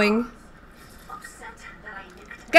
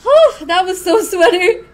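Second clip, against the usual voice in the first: laughing through the words; laughing relief; 8 semitones higher, 4 dB louder than usual. Real, not acted. Streamer laughing after a sweaty match, laughing. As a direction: laugh off the nerves.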